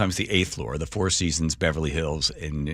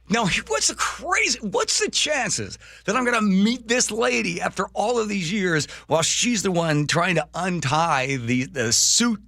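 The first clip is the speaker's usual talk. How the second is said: very high-pitched, incredulous marvelling; amazed disbelief; 12 semitones higher than usual. Real, not acted. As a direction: marvel at a wild coincidence, voice way up. Teller marvels at the coincidence.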